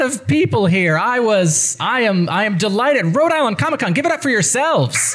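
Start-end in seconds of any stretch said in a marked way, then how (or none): 3.2-5.1 high, excited call to cheer; hyping the crowd. Real, not acted. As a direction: pump up the audience. Moderator hypes the crowd.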